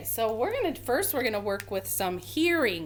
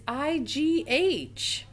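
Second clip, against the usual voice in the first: each letter slow and higher; spelled out slowly; 7 semitones higher, much slower than usual. Shown as a demonstration. Teacher spelling letters slowly for the class. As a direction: spell I, G, H deliberately, one at a time. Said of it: "the strangest way to get the long I sound is".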